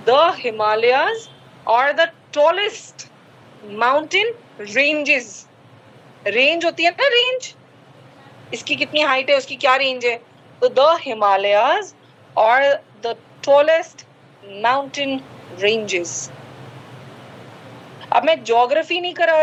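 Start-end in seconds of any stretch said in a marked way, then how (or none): 0.1-5.5 very slow, every word drawn out; slow model sentence. Shown as a demonstration. Teacher dictating the corrected sentence, very slow. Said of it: "आपको बोला जाता". 10.7-16.3 very slow, every word drawn out; slow model sentence. Shown as a demonstration. Teacher dictating the corrected sentence, very slow. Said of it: "teacher repeats corrected sentence".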